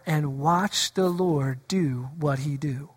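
low, soft, drawn-out; slow low aside; hushed and slow. Acted. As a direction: let it settle in a low murmur. Quoting scripture slowly, playfully.

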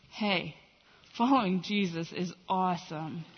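low, laid-back disciple voice; disciples' voice. Acted. Disciples marvel among themselves at following Jesus, low and laid-back. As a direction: drop low, a casually pleased follower. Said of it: "disciples up until this point are thinking".

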